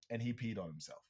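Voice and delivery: usual speaking voice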